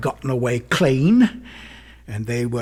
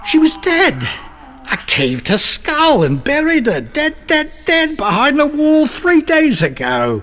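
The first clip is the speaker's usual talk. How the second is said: high, loud, unhinged insistence; frantic denial; 5 dB louder than usual. Acted. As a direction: hammer the word dead, losing control.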